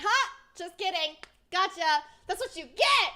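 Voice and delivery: loud, high-pitched taunting reveal; gleeful gotcha. Retold or imitated. Both pranksters reveal the joke, gleefully taunting.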